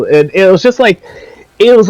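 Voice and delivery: normal talking voice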